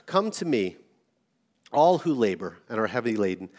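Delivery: low, soft, tender scripture reading; gentle invitation. Acted. Preacher reading Jesus' words to the heavy laden, gently.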